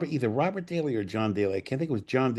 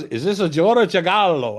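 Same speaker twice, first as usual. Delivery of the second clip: high, put-on Italian accent; 7 semitones higher, 6 dB louder than usual. Dino calls the writer at four a.m., high Italian accent. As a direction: imitate an Italian producer on the phone, accent thick. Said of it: "when you do the accent".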